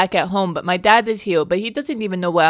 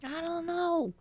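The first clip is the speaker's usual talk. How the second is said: tiny high voice; 9 semitones higher, 13 dB quieter, slower than usual. Acted. Answering as the tiny creature.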